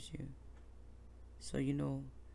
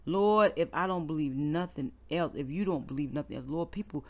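heartfelt prayer; 5 semitones lower, 7 dB quieter than usual. Real, not acted. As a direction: pray aloud gently, like leading a congregation. Leads a prayer on air.

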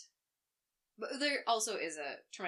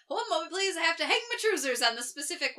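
higher and louder, abruptly breaking the mood; sudden loud interruption; 9 semitones higher, 8 dB louder than usual. Retold or imitated. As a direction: snap out of it with a loud hold on. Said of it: "and then you're like".

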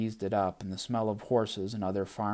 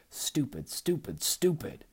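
self-scolding; 6 semitones higher than usual. Acted. Self-reproach building.

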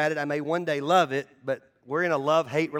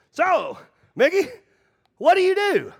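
high curious golfer; 13 semitones higher, 6 dB louder than usual. Retold or imitated. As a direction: play the nosy golf buddy lilting up. Golf partner asks, acted out.

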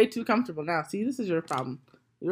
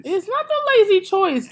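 loud, much higher protest; heated protest; 13 semitones higher, 11 dB louder than usual. Real, not acted. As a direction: push back hard. Heated rap argument.

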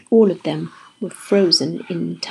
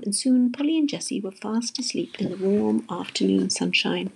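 ordinary delivery

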